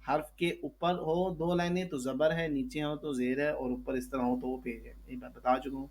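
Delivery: ordinary talk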